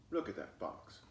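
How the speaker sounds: ordinary delivery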